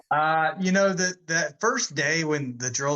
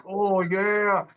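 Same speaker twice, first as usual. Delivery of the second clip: drawn-out, higher-pitched gleeful reaction; delighted recruits; 5 semitones higher than usual. Retold or imitated. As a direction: imitate buddies relishing a treat. Recruits thrilled by the smuggled tobacco.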